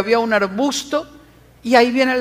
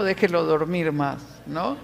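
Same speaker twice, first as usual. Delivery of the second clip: ordinary talk, nothing marked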